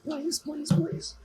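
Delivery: high, soft desperate begging; quiet high-pitched pleading under the breath. Real, not acted. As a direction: beg the stuck part to come free. Struggling with a loose part.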